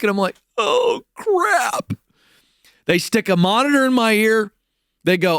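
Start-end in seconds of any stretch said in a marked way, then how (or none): 0.6-1.9 much higher, a cracking panicked yelp. Retold or imitated. Rookie called to the anchor desk, cracking high in panic. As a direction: let the voice break in alarm.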